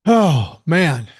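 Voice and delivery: puffing after a rant; out of breath. Real, not acted. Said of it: "I'm getting out of breath here".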